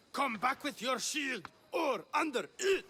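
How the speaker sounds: hammy stage war cry; rehearsed battle cry. Acted. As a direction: bellow it like a general rallying soldiers. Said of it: "You already heard the first part".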